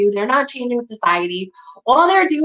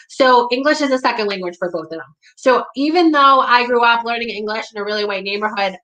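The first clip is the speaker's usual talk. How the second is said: ordinary delivery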